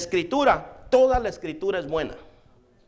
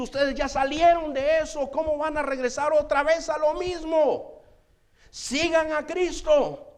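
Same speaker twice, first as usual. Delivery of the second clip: raised, ringing sermon voice; full-force preaching; 6 semitones higher than usual. Acted. Preacher urging his brothers with ringing force. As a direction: preach it out to the congregation.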